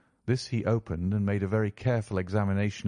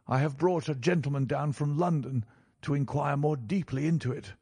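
higher-pitched banker, firmly refusing; stubborn, aggrieved banker in a raised pitch; unyielding banker; 7 semitones higher than usual. A narrator's reading. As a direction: insist that you will not drop it. Banker refuses to drop the matter.

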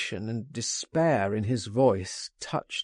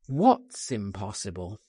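ordinary delivery, nothing marked

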